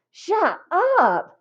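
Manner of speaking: high shrill scream. A narrator's reading. Jeff screaming at Lori to shut up, high scream. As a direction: scream it at her. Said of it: "screamed Jeff".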